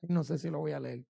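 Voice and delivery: hesitant doubt. Retold or imitated. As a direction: drop to a hushed, doubtful "No sé si lo voy a leer". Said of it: "Después puse el celular y dije".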